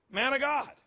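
high-pitched, held up at the end; pointed repeat. Real, not acted. Preacher repeats Jonah's title to the church, pointed repeat. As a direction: repeat the title pitched well up, leaving it hanging.

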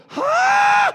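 very high, loud yelp; startled "huh". Acted. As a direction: yelp it like a stunned devil. Acts out devil's startled reaction.

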